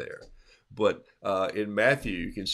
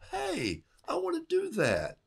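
put-on high, eager childlike exclaim; excited wife. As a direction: want it right now, thrilled. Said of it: "wife who hasn't lost zeal".

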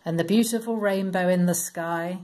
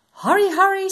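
much higher, louder goose voice; hurrying geese; 13 semitones higher, 6 dB louder, faster than usual. Acted. Geese rushing to the ark, high loud hurry. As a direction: urge everyone on like a flustered goose. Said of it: "said the geese".

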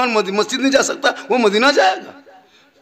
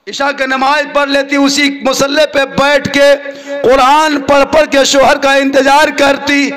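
slow, loud, high preaching; fervent sermon narration; 7 semitones higher, 8 dB louder, slower than usual. Acted. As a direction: sing out the holy story to the crowd. Preacher narrates Fatima's devotion. (बुलंद आवाज़ में जोशीला बयान)

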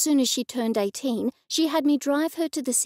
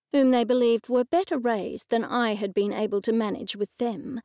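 ordinary delivery

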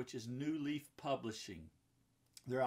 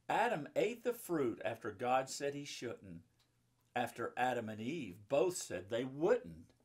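lively rhyming read-aloud, a bit louder; reading to children; 5 dB louder than usual. A narrator's reading. Mom reading a Bible picture book to children, lively rhyming read-aloud. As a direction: bounce the rhymes for the kids.

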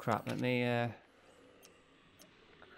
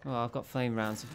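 ordinary delivery

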